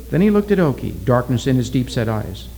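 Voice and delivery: ordinary delivery, nothing marked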